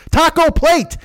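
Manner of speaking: high, loud exasperated yell; losing his mind. Retold or imitated. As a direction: yell it like the last straw. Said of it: "I wanted to lose my mind and say that shit".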